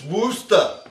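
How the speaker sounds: "Worcester" as Wooster with a dropped R, Massachusetts style; Massachusetts accent. Shown as a demonstration. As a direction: say "Worcester" like a Massachusetts local, wuss-tuh. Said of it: "if you're viewing it in Massachusetts".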